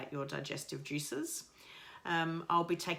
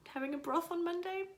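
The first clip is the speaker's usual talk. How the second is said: teary excited; 12 semitones higher than usual. Real, not acted. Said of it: "I'm getting all teary".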